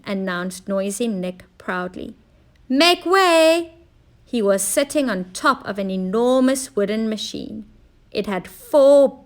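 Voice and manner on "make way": higher, louder; proud call. Acted. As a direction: clear the way proudly. Inventor rolling out machine.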